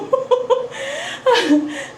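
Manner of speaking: a bright giggle, pitched well up; high giggle after a tongue slip; high embarrassed laugh. Real, not acted. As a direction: laugh off the stumble. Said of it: "nabubulol ako".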